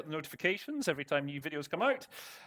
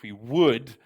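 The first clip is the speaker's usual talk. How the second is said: would leaned on and louder; stress on would; 7 dB louder than usual. Shown as a demonstration. Teacher stresses would to show the idea of wishing. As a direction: push the word would to show wish. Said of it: "That sort of slight emphasis in the would".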